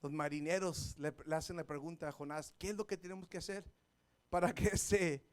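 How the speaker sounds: low, soft storytelling; hushed preaching. Acted. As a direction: draw them in, barely above a murmur. Soft narrated sermon. (predicación suave y baja)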